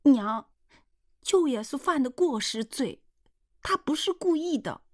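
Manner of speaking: put-on high-pitched girl's protest; pleading daughter. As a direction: plead your uncle's case in a high young voice. Daughter defends uncle to mother.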